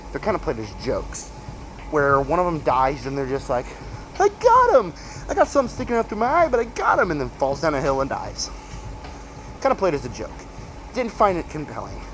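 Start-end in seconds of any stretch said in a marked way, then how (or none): 4.2-7.1 high, loud, fast triumphant cry; excited triumphant shout. Retold or imitated. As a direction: yell it like a goofy hero who won. Detective character mocked in recap.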